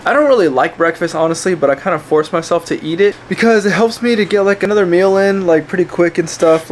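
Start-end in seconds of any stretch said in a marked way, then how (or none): none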